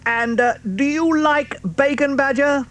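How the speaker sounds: high, teasing fox question. Acted. Mister Fox to Badger, high teasing question. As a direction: tempt him playfully.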